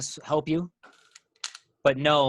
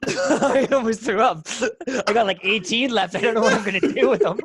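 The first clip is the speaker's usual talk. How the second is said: laughing; 8 semitones higher, 6 dB louder than usual. Real, not acted. Laughing about sausages.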